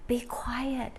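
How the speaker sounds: breathy hush to the class; whisper. Acted. Teacher whispering to the children to keep quiet. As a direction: whisper it like the baby is asleep. Teacher shushing kids in story.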